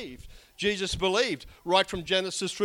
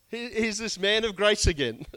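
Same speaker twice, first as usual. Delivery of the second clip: usual speaking voice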